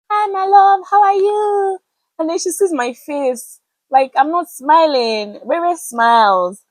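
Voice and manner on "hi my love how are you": high, loud, sugary imitation of a doting pastor; sweet pastor greeting. Pastor Ife greeting her warmly at church, imitated high and sweet. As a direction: coo it up high like a fond pastor. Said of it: "she's like".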